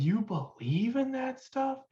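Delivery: high-pitched incredulous question; mocking disbelief. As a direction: scoff in disbelief, pitch swooping way up. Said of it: "just like that".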